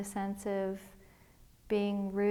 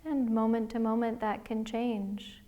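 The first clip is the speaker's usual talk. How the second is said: guided meditation. Acted. Liz leading listeners through a body scan, calm guided meditation voice. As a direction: gently guide listeners inward, one breath at a time.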